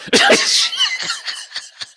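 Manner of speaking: high-pitched burst of laughter. Real, not acted. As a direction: let a real laugh break out. Laughing at own realization.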